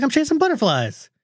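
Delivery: put-on high, innocent child voice; little sister voice. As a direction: play the little kid, pleased with herself. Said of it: "She's like".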